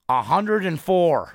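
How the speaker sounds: slow, loud, deliberate number; slow stern cop. Retold or imitated. Cop telling speeding driver his speed, slow and loud. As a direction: hammer each word out like a stern cop. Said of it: "He goes".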